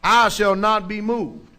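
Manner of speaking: slow, loud scripture declaration; slow proclaiming. Real, not acted. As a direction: plant each word like a rock.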